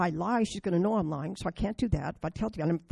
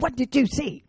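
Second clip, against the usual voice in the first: higher, louder stern mom demanding; a stern mother demanding an answer; mom's angry demand; 6 semitones higher, 7 dB louder than usual. Retold or imitated. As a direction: confront her like an angry mom. Mom confronting her angrily.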